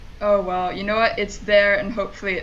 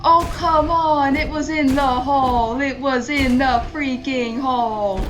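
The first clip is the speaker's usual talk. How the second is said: exasperated wail; 3 semitones higher, 4 dB louder than usual. Real, not acted. Player protesting that it was in, exasperated wail. Near miss in the game.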